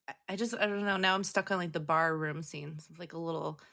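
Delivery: usual speaking voice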